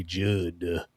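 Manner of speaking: low, soft "Judd" with a heavy final D; emphasis on the D. Shown as a demonstration. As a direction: lean on the closing D of "Judd". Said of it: "put an emphasis on the D".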